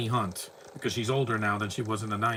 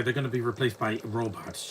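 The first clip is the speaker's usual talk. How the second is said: normal talking voice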